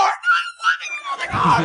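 very high squeaky wailing refusal; crying whine. Retold or imitated. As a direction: wail it like a bawling child. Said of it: "je commence à pleurer".

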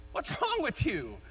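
a slow, gentle, respectful ask. Retold or imitated. David gently asking his brothers, respectful and slow. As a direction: ask it kindly, as a puzzled younger brother. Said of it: "I can hear David saying that respectfully".

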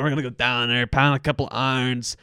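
flattened Pittsburgh "down" vowels; Pittsburgh accent. Shown as a demonstration. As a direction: imitate a Pittsburgh local. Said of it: "Pittsburgh is where you get".

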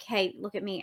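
low soft hey look at me. Shown as a demonstration. Players mimicking the butterflies, low and soft. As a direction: say it low and soft, barely calling. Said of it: "We're like".

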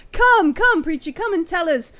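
acted fussing stallholder, voice lifted; effusive welcome. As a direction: usher an honoured guest forward. Stallholder eagerly welcomes the preacher.

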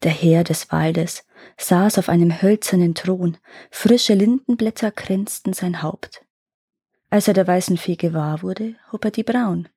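ordinary delivery, nothing marked

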